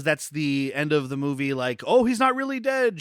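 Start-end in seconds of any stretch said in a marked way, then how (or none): 1.9-3.0 high-pitched feigned surprise; mock shock. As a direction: gasp out a fake big-twist reveal. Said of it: "shocker thing".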